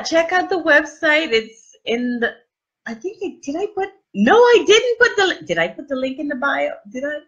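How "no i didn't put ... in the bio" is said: usual speaking voice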